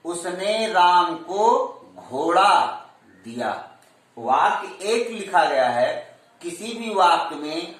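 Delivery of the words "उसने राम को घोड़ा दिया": slow build to घोड़ा, set off by pauses; teacher's demo with the weight on घोड़ा; stress on घोड़ा. Shown as a demonstration. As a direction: pause before घोड़ा and hit it, दिया falling away. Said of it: "तीसरा वाक्य इस तरह से".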